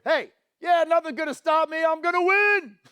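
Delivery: cocky ego voice. Pretend. The speaker mocks an audience member's ego, with a loud, cocky boast. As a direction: puff up and crow like you can't lose. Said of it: "That is ego".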